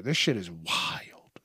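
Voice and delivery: hushed awed whisper; whispered amazement at the picture. Real, not acted. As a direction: breathe it out in disbelief.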